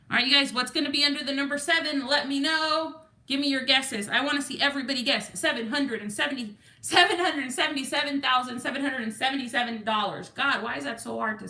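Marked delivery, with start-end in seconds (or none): none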